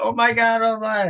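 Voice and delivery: high-pitched thrilled outburst, retold; happy exclamation. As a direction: act out being overjoyed, voice jumping up high. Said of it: "I was so happy".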